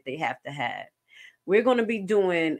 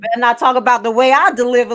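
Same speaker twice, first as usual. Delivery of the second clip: high, loud, fast put-on voice; mock impression of Vivian; 5 semitones higher, 11 dB louder, faster than usual. Host imitating Vivian's mic delivery, loud and high. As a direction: ham up her fiery delivery. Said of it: "Look at this delivery".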